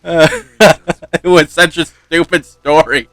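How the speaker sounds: slow words broken up by laughter; real amused laughing. Real, not acted. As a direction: laugh through the line at your own silly story. Laughs at own story.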